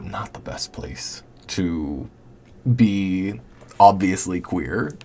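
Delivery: whispered, slowed down; a slow confiding whisper about Texas; hushed aside. Real, not acted. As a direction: drop it to a secret whisper.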